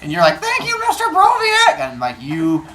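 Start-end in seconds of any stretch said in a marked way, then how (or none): 0.4-1.8 high, loud call to the teacher; hammed-up fleeing kid's shout; mock grateful yell. Kid yelling thanks while fleeing.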